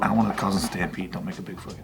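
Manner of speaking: fast, half-whispered laughing plea; hushed aside. Retold or imitated. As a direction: whisper it, trying not to be noticed. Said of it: "And I was like".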